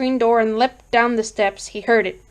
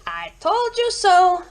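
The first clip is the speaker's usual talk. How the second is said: righteous told-you-so; 9 semitones higher, slower than usual. Acted. Narrator voices neighbours' righteous look.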